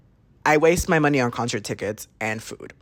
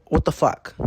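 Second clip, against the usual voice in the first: usual speaking voice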